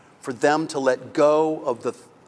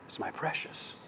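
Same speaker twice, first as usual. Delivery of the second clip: breathy whispered precious; whispered Gollum-like; 2 semitones higher, 10 dB quieter than usual. Retold or imitated. Preacher retelling Bilbo's gaze at the ring, whispered. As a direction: hiss it like a possessive creature. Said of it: "he goes".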